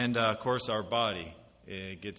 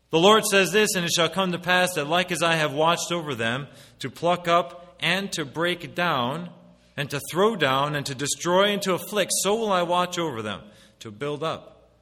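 loud, high solemn reading of Scripture; loud King James reading; 6 semitones higher, 8 dB louder than usual. Acted. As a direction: proclaim the verse.

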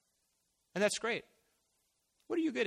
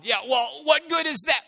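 much higher, louder incredulous shout; scoffing protest; 12 semitones higher, 12 dB louder than usual. Retold or imitated. Moses doubting God about his rod, loud and incredulous. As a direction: throw the question back in disbelief.

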